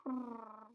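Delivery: rolled imitated purr in another voice; playful cat purr. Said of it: "Tabby purred some more".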